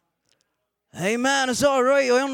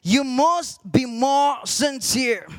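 slow, deliberate pronouncement; commanding voice; slower than usual. Retold or imitated. The voice answering the preacher's prayer, slow solemn command. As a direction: spell out the command slowly, word by word. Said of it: "the voice said again".

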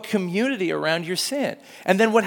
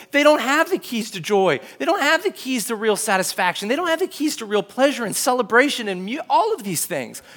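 ordinary delivery

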